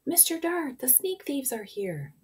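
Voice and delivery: high-pitched cry for help, easing off; storybook shout for the police; loud terrifying shout. A narrator's reading. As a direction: yell the alarm high and drawn out. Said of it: "shouted in his loudest and most terrifying voice".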